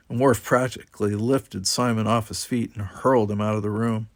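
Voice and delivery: ordinary delivery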